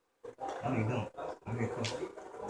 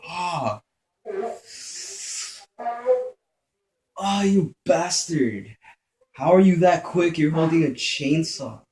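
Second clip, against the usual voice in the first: loud, raised voice of disbelief; real shock; 7 semitones higher, 7 dB louder, slower than usual. Real, not acted. Player killed by chainsaw enemy, shocked high protest. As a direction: protest loudly and high, still rattled. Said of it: "Why am I still shocked".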